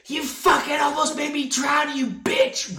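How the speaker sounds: put-on high, loud outraged kid shout; little boy voice. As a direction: yell it like an indignant little boy. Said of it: "like, a little boy voice".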